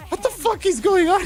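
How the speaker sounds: very high, squeaky mock bewilderment; comically baffled falsetto; retold confusion. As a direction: imitate a man totally lost, voice way up. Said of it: "he was so confused".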